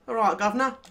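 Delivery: light mock Cockney greeting; a quick cheeky Cockney send-up. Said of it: "even I take the piss out of Cockney".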